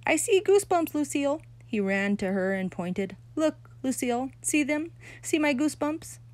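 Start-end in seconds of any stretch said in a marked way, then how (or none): 0.1-1.4 high, loud and eager; a boy eagerly showing Lucille his arms; eager shout. A narrator's reading. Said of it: "shouted Sheldon". 3.3-6.2 high and eager; a boy begging Lucille to notice him; eager pleading. A narrator's reading. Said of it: "he asked".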